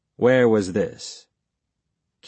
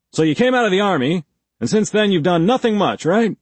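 higher, louder pushy cop voice; gruff interrogator; 10 semitones higher, 5 dB louder, faster than usual. A narrator's reading. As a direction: press him like a hostile detective. Blake sums up, pressing.

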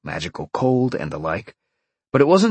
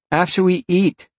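firm, raised warning; 9 semitones higher, 4 dB louder than usual. A narrator's reading. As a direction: warn them, insisting. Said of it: "Jolene warned".

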